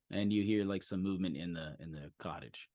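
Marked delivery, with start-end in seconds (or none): none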